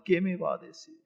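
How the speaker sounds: hushed, apprehensive aside; anxious inner thought. Acted. Minister watches the owner's mouth, anxiously waiting. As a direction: think it aloud very softly. Nervous minister awaits the verdict.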